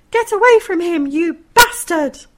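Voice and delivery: high, loud scream at the monster; furious shout. Acted. Mother screaming at the clown to leave her son, furious. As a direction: scream him off the boy. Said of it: "I snapped".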